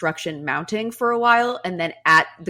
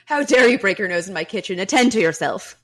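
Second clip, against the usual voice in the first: stern mom; 2 semitones higher, 6 dB louder than usual. Retold or imitated. Voicing the pragmatic mother.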